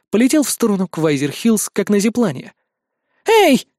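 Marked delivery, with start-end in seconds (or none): 3.3-3.7 high, loud indignant call; indignant shout. A narrator's reading. Азуми calling after the hero, indignant. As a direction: yell after someone in protest. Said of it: "возмутилась Азуми мне вслед".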